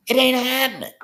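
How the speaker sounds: it ain't happening, thrown out high and loud; emphatic outburst. Real, not acted. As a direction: slam it down as final. Repeats his defiant claim.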